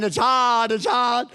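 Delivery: a touch of a put-on complainer's wail; mock whining. People in a lucky country moaning, lightly mocked whining.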